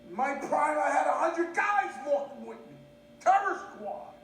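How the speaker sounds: hammed-up indignant shout; indignant boasting. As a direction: protest loudly about past glory. Indignant boast to his family.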